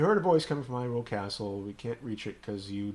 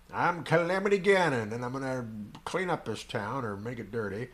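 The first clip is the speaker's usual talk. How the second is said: drawling cowboy villain; Western villain; 3 semitones higher, 4 dB louder than usual. Pretend. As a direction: ham up a Western bad guy. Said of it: "sounds like a villain from a Western".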